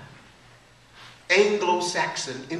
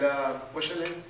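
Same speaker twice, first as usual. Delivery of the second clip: ordinary delivery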